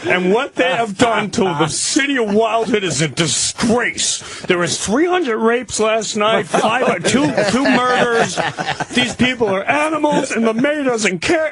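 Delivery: frazzled cop ranting about the town; cop losing it. Retold or imitated. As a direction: rant half-laughing, voice way up. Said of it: "He's just losing it".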